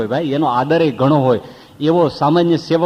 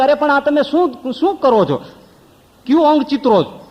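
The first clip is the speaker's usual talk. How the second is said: pained cry; 10 semitones higher than usual. Retold or imitated. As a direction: yelp at every prick. Man screaming at tattooist.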